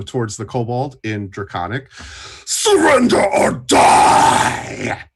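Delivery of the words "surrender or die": loud booming dragon voice, drawn out; half-dragon. Acted. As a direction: bellow it slowly like a dragon. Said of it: "in a loud, deep, booming voice".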